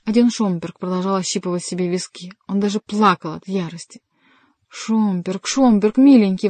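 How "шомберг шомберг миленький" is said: building from ordinary voice to a loud plea; pleading exclamation. A narrator's reading. Henri begs his dear Schomberg to stop, exclaiming. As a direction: call the name, louder each time. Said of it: "воскликнул Генрих".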